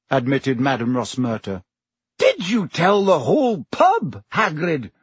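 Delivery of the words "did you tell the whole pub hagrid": higher-pitched exasperated scolding. A narrator's reading. Professor scolds Hagrid for telling the pub, exasperated. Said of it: "said Professor McGonagall exasperatedly".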